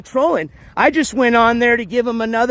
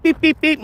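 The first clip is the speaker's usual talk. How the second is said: higher beep beep beep like a metal detector; imitated detector beeps; 7 semitones higher than usual. Streamer describing detecting videos, higher mock beeps. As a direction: chirp it like the machine finding metal.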